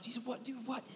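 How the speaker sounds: high, soft disbelieving whine; mock pleading whine. Worn-out dad complaining to Jesus, high soft whine. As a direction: plead softly, hammed-up confusion. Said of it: "and I'm like".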